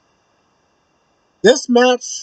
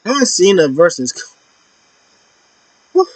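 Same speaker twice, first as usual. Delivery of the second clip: normal talking voice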